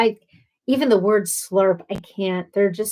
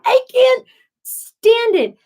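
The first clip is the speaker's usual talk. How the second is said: high, loud, drawn-out mock fit; exasperated outburst; 13 semitones higher, 6 dB louder, slower than usual. Reacting to the man's mouth noises, exasperated high outburst. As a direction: squeal it out, slowly, at wit's end.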